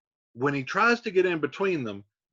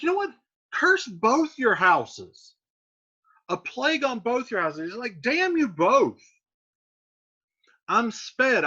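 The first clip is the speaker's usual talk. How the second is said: cursing Mercutio; 7 semitones higher, 3 dB louder than usual. Retold or imitated.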